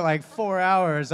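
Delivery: usual speaking voice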